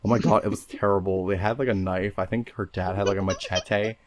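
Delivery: lightly voiced valley girl. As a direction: tell it like a ditzy valley girl.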